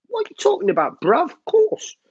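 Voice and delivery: very high, squeaky mock outburst; hammed-up high-pitched bruv voice; silly high voice. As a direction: shoot right up into a jokey falsetto. Jokey outburst, then apologises for it.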